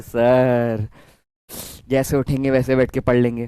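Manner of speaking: low, sleepy mock student; groggy student. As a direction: mumble like a kid just woken up.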